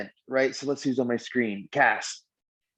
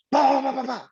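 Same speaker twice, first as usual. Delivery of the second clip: high burst of mock yapping; reactive blurting; 12 semitones higher than usual. Acting out the old me reacting without pause, reactive blurting. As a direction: fire off high nonsense syllables as a knee-jerk retort. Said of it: "Instead of old me would do this".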